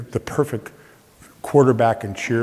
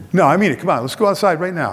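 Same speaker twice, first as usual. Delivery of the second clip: higher, louder pushy dare; mock tough challenge; 6 semitones higher, 5 dB louder, faster than usual. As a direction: goad him to step outside. [name] challenging him to fight.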